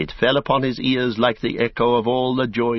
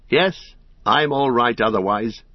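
ordinary delivery, nothing marked

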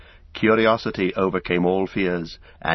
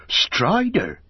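high-pitched exclamation of disbelief; astonished gasp; 11 semitones higher than usual. A narrator's reading. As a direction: blurt out the name in amazement. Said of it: "and he gasped".

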